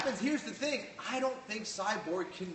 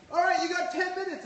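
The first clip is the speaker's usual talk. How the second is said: high, raised backstage call; Burt Watson; 12 semitones higher, 4 dB louder than usual. Retold or imitated. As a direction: imitate an official calling out the time warning. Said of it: "Burt Watson would be back there going like".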